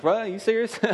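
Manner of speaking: very high, comic disbelief; mock-stunned, pitch leaping up; incredulous. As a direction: squeak out a baffled question. Disciples' disbelief, acted out.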